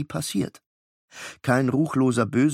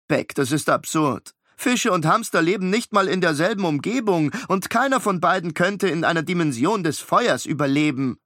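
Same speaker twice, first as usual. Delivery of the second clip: put-on higher, precise know-it-all boy; clever twin; 6 semitones higher than usual. Miles correcting his brother, higher know-it-all boy. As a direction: lecture your brother, a touch prim.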